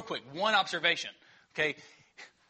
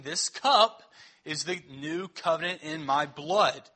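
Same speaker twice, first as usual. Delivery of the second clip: stretched out, louder and slow; slow emphatic reading; 3 dB louder, slower than usual. Acted. Pastor giving Jesus' words at the table, slow and emphatic. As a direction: lean hard on every word.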